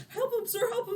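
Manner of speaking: put-on high-pitched urgent child's plea; pleading boy. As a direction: beg for help in a high voice. Boy pleads for help, acted.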